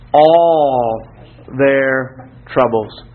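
"all" stretched out long, then a pause; stress on all. Shown as a demonstration. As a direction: underline "all", then slowly set down "their troubles". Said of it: "underline this word".

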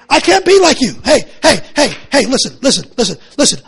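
loud, insistent pulpit plea; fervent preaching. Acted. Preacher pleading with his church, loud and insistent. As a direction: hammer each word from the pulpit.

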